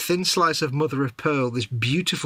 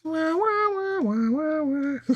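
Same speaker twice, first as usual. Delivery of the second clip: very high wah-wah trombone noise; high sad trombone imitation. Mock sad trombone joke.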